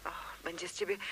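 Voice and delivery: hushed whisper into the phone. Acted. She calls the detective secretly, whispering into the phone. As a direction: whisper as if someone might overhear.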